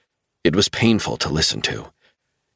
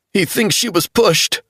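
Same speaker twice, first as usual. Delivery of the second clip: much higher, urgent pleading; desperate insistence; 13 semitones higher than usual. A narrator's reading. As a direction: make him believe you. Travell insisting.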